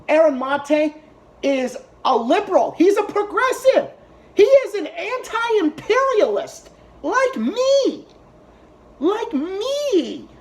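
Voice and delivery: high-pitched, drawn-out emphatic outburst; real indignant insistence. Real, not acted. Defending Aaron Mate as anti-imperialist like me, indignant insistence. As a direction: stretch the key words and pitch them right up.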